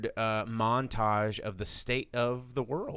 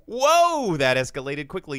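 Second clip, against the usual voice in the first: shocked whoa; 5 semitones higher, 9 dB louder than usual. Retold or imitated. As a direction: react as if stunned by the escalation. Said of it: "And it's like".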